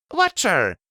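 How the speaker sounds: loud, high call. A narrator's reading. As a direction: shout his name, excited. Calling out to the robot.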